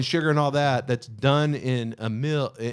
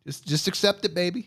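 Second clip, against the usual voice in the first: mock sweet talk; 7 semitones higher than usual. Teasing mock voice to friend.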